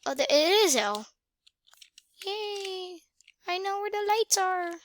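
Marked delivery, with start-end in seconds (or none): 2.3-4.7 high, small-voiced cheer; cute high-pitched yay; little celebration. Real, not acted. Little celebration on finding the key.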